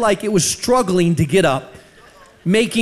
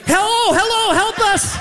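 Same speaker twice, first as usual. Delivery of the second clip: very high-pitched comic calling out; mock cry for help; 13 semitones higher than usual. Preacher reenacting banging in stuck elevator, desperate calling. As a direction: call for rescue in a hammed-up high voice.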